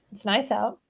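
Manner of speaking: soft, understated mumble; mumbled. A narrator's reading. Tubbo making small talk beside Tommy, mumbled. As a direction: mumble it quietly, offhand. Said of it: "Tubbo mumbled".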